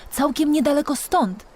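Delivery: plain reading voice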